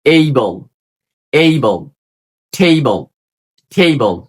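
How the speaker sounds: "able" and "table" with a full ay-ee glide; example pronunciation. Shown as a demonstration. Teacher gives examples of A, "able" and "table" with drawn-out glide. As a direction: stretch the first vowel of "able" and "table", falling. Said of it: "For example".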